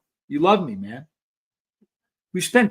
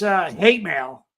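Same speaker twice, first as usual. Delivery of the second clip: ordinary talk, nothing marked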